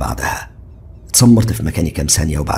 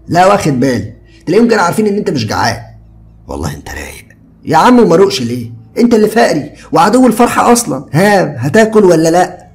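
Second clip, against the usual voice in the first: higher, louder easygoing friend; carefree young man, higher and louder; Ziad's casual voice; 13 semitones higher, 9 dB louder than usual. A narrator's reading. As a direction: toss it off lightly like a carefree buddy. Ziad teasing.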